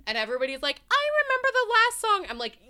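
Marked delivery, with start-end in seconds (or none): 0.9-2.3 very high, loud squeal; mock eager boast. Fans claiming to recall the songs, mock eager squeal. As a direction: pipe it up high, mocking their enthusiasm.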